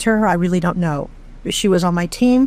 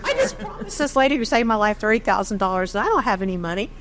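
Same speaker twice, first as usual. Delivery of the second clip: laughing through it; 3 semitones higher, 4 dB quieter than usual. Retold or imitated. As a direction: laugh at your own rash promise. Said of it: "And I was laughing".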